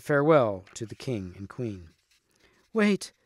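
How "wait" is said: mild cry. A narrator's reading. Guinevere stopping Lancelot as he leaves, mild cry. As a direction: cry out to stop him, pitched high. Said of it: "cried Guinevere".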